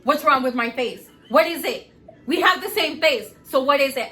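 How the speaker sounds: loud, challenging back-talk; mock defiance. Mother imitating son's back-talk, mock defiance. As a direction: throw it back as a retold challenge. Son talking back in quarrel.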